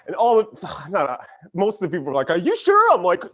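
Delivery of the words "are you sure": high, loud imitation of a doubter; mock doubting driver. Churchgoers to parking guy, mock high-pitched doubt.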